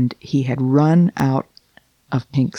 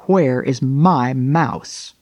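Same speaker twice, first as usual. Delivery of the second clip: slow cat question; much slower than usual. Acted. Cat's imagined question.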